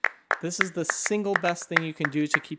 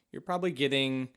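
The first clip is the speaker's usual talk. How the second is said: ordinary talk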